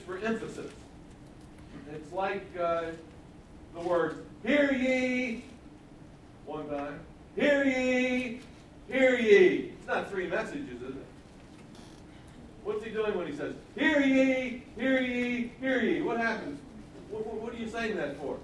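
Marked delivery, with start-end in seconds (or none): 4.4-5.4 high, loud town-crier style call; attention-getting cry. Shown as a demonstration. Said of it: "That gets somebody's attention". 7.4-9.7 high, loud town-crier style call; attention-getting cry. Shown as a demonstration. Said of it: "That gets somebody's attention". 13.8-16.0 high, loud town-crier style call; attention-getting cry. Shown as a demonstration. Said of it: "That gets somebody's attention".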